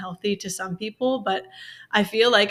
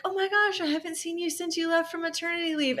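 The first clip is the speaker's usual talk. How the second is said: much higher, gushing mock-friendly voice; imitating a bubbly coworker; 9 semitones higher than usual. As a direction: squeal it like an oblivious colleague.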